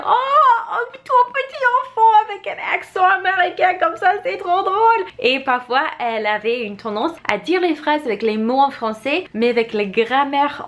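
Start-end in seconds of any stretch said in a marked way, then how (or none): 0.1-5.0 put-on high, gushing grown-up voice cooing over a child; cooing amused adults. Adults fawning over a little girl's American accent, high cooing voice. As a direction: coo at the little kid like a delighted grown-up. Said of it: "les adultes ils aimaient bien parce qu'ils disaient".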